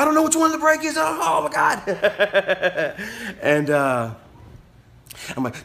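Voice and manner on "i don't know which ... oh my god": very high mock panic ending in laughter; [name] panicking. Learner driver lost on the pedals, high hammed-up panic breaking into laughter. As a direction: imitate a frantic wail, stretching the last words.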